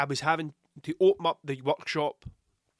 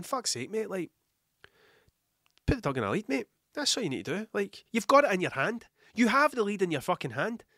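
exasperated; 6 semitones higher than usual. Acted. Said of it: "there was a bit of me going".

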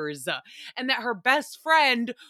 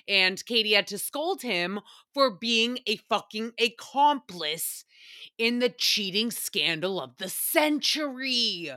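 usual speaking voice

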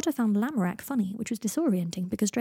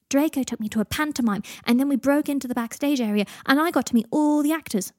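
louder, higher, eager retelling; excited child; 5 semitones higher, 6 dB louder than usual. A narrator's reading. Teddy telling Harry about sneaking backstage with Draco, excitedly. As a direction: build with glee to the best part.

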